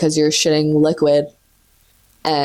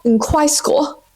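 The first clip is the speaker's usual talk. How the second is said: in high school lifted higher; a raised in high school; 8 semitones higher, slower than usual. Shown as a demonstration. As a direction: pitch in high school up brightly.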